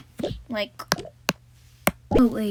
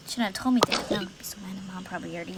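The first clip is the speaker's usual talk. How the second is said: whisper; 4 semitones lower, 7 dB quieter, much faster than usual. Real, not acted. Whispered aside to camera.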